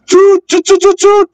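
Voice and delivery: high loud horn toots. Acted. Bertie the bus hooting to stop the train.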